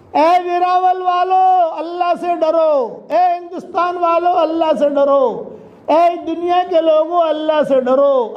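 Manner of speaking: sermon warning proclaimed to all; preacher's call. Real, not acted. Preacher hailing whole peoples.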